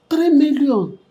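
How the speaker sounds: loud, high exclamation of shock; astonished. Acted. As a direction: blurt it out in sharp disbelief.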